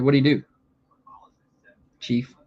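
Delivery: ordinary talk, nothing marked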